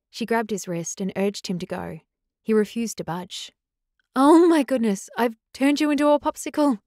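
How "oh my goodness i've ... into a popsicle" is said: high, loud alarmed cry; shouted. A narrator's reading. Girl seeing the alpha frozen, shouted. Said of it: "she shouted".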